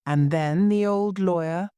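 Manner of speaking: plain reading voice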